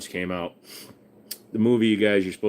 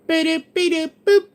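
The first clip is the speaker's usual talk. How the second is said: high beeping robot; toy robot voice; 6 dB louder than usual. Pretend. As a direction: chirp like a tiny robot.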